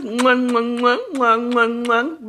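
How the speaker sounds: playful kiss sounds blown at the camera; blown kisses. Real, not acted. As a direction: smack out a string of air kisses. Blowing kisses to viewers.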